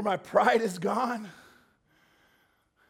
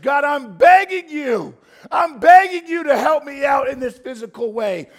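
desperate shouted prayer; 7 semitones higher, 13 dB louder than usual. Acted. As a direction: pour out every need, begging.

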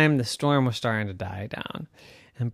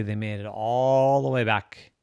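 plain reading voice

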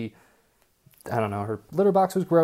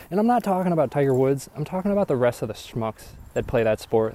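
ordinary talk

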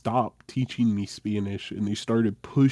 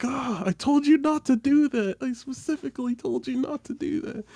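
scolding mom. Retold or imitated.

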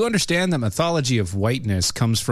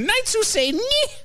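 high squeaky Nyeh; knights who say Nyeh. Retold or imitated. Mocking a speaker's changing voices, high and squeaky. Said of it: "her voices went from".

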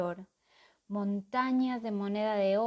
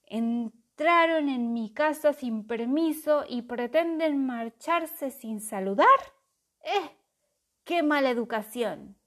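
dragon voice; 4 semitones higher, 8 dB louder than usual. A narrator's reading. Dragon scolds intruders.

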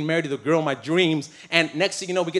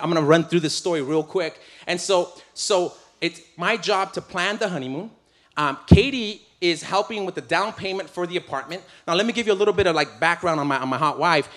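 normal talking voice

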